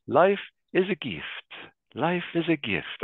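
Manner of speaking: imitating a priest's heavy Italian accent, pitched high; mock Italian priest, high and sing-song. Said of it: "very heavy Italian accent".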